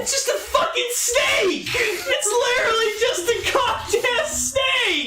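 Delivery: high, slow, laughing disbelief; laughing shout. Real, not acted. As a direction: shriek it through laughter. Outburst at the monster.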